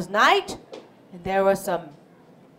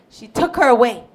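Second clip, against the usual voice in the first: ordinary talk, nothing marked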